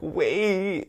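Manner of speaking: high, drawn-out wait; calling after someone. Retold or imitated. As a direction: plead after someone walking off.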